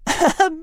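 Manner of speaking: light high cat giggle; a quick amused titter. Acted. Cat Liz giggling at the small bird, high and light. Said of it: "Liz giggled".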